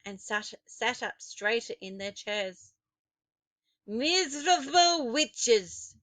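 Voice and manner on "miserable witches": loud, higher, slow contemptuous witch voice; Grand High Witch scolding her witches; scornful witch yell. A narrator's reading. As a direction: sneer and yell at them. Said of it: "she yelled".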